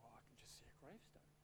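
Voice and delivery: very low, hushed quick aside; muttered inner thought. Retold or imitated. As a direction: mutter it under your breath, underwhelmed. Underwhelmed private thought after grand pronouncement.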